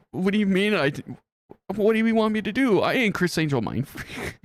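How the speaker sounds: high-pitched comic protest; mock exasperation. Supervisor rehearsing a comeback to complainers, mock exasperation. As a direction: play at being helpless with a complainer. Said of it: "pop that up being like".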